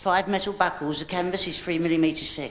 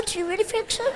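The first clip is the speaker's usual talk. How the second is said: much higher, soft squeaky repeat; squeaky disbelief; 4 dB quieter, faster than usual. Acted. Repeat even higher, softer.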